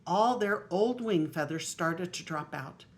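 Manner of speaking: lively storybook reading aloud; grandma reading a picture book aloud; reading to children. Acted. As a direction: read it out to the little grandchildren.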